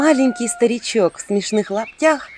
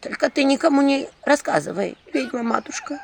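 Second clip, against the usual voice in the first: higher pleading village woman; lightly voiced pleading woman; pleading peasant woman; 8 semitones higher than usual. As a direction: beg like a frightened old villager. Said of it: "взмолилась баба".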